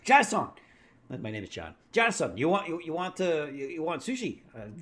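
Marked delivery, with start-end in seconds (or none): 0.0-0.5 high, loud mock Japanese accent; hammed-up Japanese accent, pitched way up; bad Japanese accent. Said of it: "that's the worst Japanese accent ever". 1.9-4.4 higher-pitched, badly faked Japanese accent; halting mock Japanese accent, pitched up. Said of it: "that's the worst Japanese accent ever".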